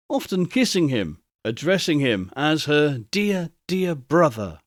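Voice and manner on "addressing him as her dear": plain reading voice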